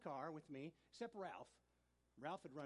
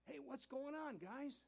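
Ralph's greeting; 12 semitones higher, 4 dB louder, slower than usual. Retold or imitated. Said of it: "he says".

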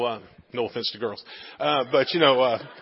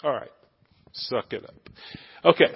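ordinary talk, nothing marked

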